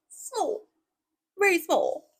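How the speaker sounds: high-pitched, drawn-out "small"; tiny high voice on "small"; playfully tiny "small". Shown as a demonstration. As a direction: squeak out "small" through amusement. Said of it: "you say it like that".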